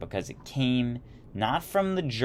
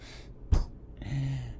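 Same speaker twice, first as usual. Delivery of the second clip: laughing; 4 semitones higher, 4 dB quieter than usual. Real, not acted. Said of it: "I lost it".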